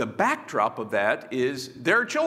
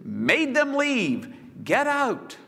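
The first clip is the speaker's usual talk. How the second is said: slow emphasis; 8 semitones higher, slower than usual. Shown as a demonstration. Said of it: "That is".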